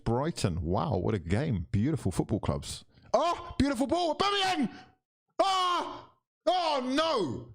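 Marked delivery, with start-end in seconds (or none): 3.1-4.7 very high screaming build-up; excited scream. Real, not acted. Fan screaming as the striker goes clean through, excited. 5.4-7.5 very high pained shriek; anguished cry. Real, not acted. Fan crying out at the missed one-on-one, anguished shriek.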